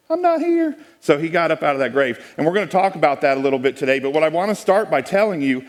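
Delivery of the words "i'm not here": high playful Jesus voice; Jesus quoted. Retold or imitated. Speaker voicing Jesus at the empty tomb, high playful voice. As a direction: pitch way up for Jesus saying it. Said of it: "He was like".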